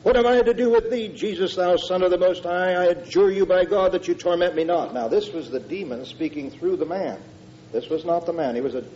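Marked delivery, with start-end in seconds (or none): 0.0-4.9 high, loud demon's outcry; a possessed man shrieking at Jesus; loud cry. A narrator's reading. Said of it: "he cried out with a loud voice".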